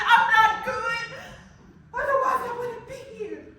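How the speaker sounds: desperate cry climbing to a breaking peak; anguished outcry. Acted. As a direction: let the despair boil over at God. Building outburst of despair to God.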